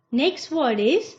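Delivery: ordinary talk, nothing marked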